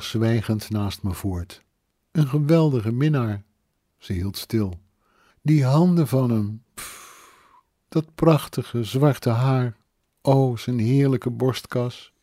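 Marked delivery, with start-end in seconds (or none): 2.1-12.1 put-on a dreamy, drunken woman, raised and slowed; longing, swaying voice of a drunk widow; tipsy grieving lover. Drunk woman swooning over her dead lover.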